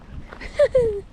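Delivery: laughing high at a failed whistle; sudden high giggle burst; high burst of laughter. Real, not acted. Tried to whistle and I can't.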